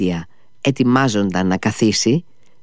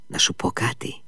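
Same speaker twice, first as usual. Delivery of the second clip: hushed, softer aside; whisper; 3 semitones lower, 7 dB quieter than usual. Acted. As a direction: lean in and whisper it. Ο Μήτια στη Φένια. (ψιθυριστά)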